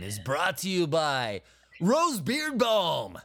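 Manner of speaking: slow commercial announcer voice; hammed-up product pitch, unhurried; mock ad read. Mock ad for beard balm.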